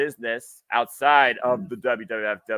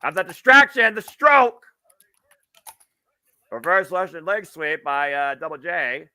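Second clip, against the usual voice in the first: much higher, louder excited call; wrestling commentator; 6 semitones higher, 5 dB louder than usual. Real, not acted. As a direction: yell the move as it hits. Live play-by-play.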